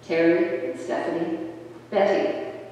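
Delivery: ordinary talk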